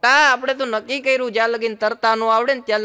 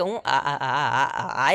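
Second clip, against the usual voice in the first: much lower, stuck on aa; a stammerer stuck before saying I love you; stammering; 9 semitones lower, slower than usual. Retold or imitated. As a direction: hang on the vowel, low.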